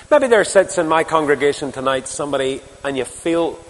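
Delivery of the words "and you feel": ordinary talk, nothing marked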